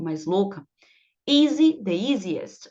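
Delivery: usual speaking voice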